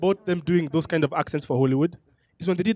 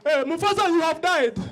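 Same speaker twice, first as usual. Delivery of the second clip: exaggerated Hollywood African accent, pitched up; 9 semitones higher than usual. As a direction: announce it in a hammed-up movie African accent.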